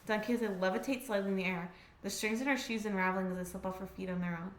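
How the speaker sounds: soft, low narration read; story narration. A narrator's reading. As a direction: read the scene quietly like a narrator. Reading narration.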